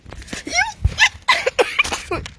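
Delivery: airy high-pitched laugh; breathy high laugh. Real, not acted. Rire soufflé aigu. (rire soufflé aigu)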